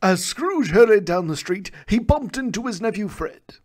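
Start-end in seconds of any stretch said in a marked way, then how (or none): none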